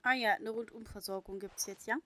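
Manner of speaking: amused surprise. Real, not acted. Streamer reacts to game.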